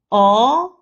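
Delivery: "oh" gliding up to high; rising tone. Shown as a demonstration. Teacher leads repeat drill, rising oh. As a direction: lift a loud, long "oh". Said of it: "The second tone is the rising tone".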